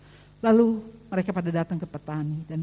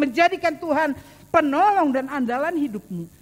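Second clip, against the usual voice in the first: rising, louder preaching, building; building sermon climax; 5 semitones higher, 4 dB louder than usual. Acted. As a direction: lift the congregation step by step. (khotbah yang makin naik dan lantang)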